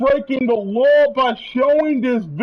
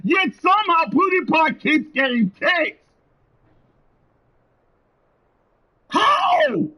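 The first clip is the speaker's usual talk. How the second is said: voice cracking with upset; 8 semitones higher than usual. Real, not acted. Ranting, voice breaking.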